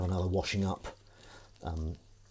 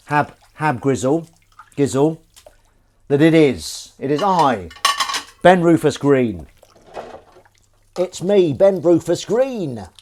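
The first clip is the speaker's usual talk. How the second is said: grand announcement; 5 semitones higher, 11 dB louder, slower than usual. Pretend. Grand self-announcement to chat.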